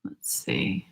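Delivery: soft unvoiced whisper; whispered to herself. Real, not acted. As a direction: whisper it under your breath. Muttering to herself while searching.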